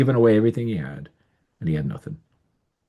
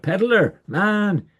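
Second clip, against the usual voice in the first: neighbors calling out; 10 semitones higher than usual. A narrator's reading. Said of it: "they'd cry out".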